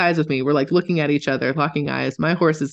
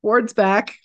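silly mock voice; 11 semitones higher, 4 dB louder than usual. Telling friends what the animals seem to think, high and goofy. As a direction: ham up a daft inner voice. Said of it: "They're just like".

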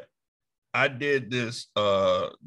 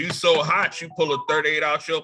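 excited riff; 6 semitones higher, faster than usual. Acted. Riffing on the joke.

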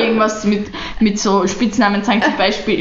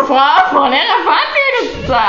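higher, laughing plea to the teacher; kids pleading; 6 semitones higher than usual. Retold or imitated. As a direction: beg like a little pupil. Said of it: "Und wir so".

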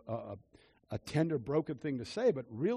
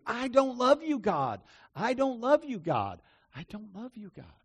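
higher, insistent chant of the words; repeated proclaiming; 6 semitones higher, 4 dB louder than usual. Retold or imitated. Preacher acting out his repeated proclamation to God, insistent. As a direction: press each "love" as he repeats it.

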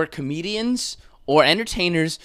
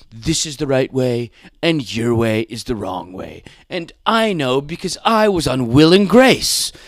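lightly voiced self-important celebrity, building louder; mock Deborah Messing; 6 dB louder than usual. Actress boasts of her sitcom credentials, mock pompous. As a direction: preach it smugly, swelling to loud at the end. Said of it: "You don't need to be spreading".

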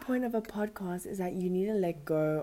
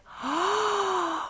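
gasp; 11 semitones higher than usual. Retold or imitated. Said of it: "I was like".

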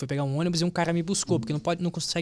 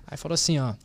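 usual speaking voice

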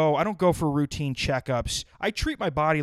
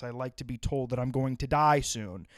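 ordinary talk, nothing marked

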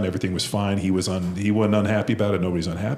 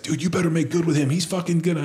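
worried teammate warning; 10 semitones higher than usual. Retold or imitated. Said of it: "And Trey's like".

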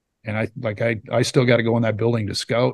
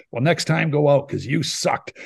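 slow, higher put-down, imitating a teammate; mock scornful punchline; 4 semitones higher than usual. Teammate ends the argument with a jab, imitated slow scorn. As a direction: drag out the insult for the punchline.

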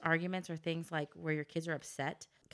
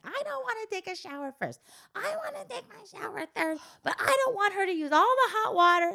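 put-on much higher pleading kid voice; whiny child Anna; 14 semitones higher, 7 dB louder than usual. Mom imitating her daughter Anna arguing about showers, high whiny kid. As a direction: whine it like an upset little girl. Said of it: "with Anna, it's just, it's incoherent".

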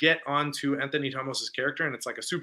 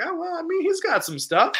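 much higher, sudden squeaky pitch; surprised realization. Retold or imitated. Himself at the movie reacting to the suit, high squeak. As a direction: jump way up in pitch, mock discovery. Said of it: "I was like".

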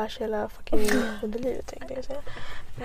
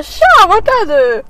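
loud greeting; 6 semitones higher, 6 dB louder, much slower than usual. Retold or imitated. Greeting on phone.

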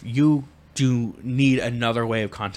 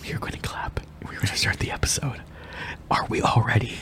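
whispering; 7 dB quieter than usual. Real, not acted. Keeps whispering.

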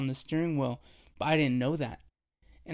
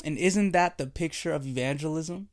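ordinary talk

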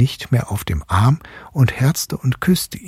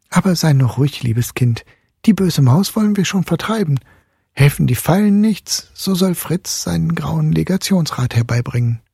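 higher, soothing mother; gentle mother voice; 8 semitones higher than usual. A narrator's reading. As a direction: reassure a child as her mother. Mother comforting Marie.

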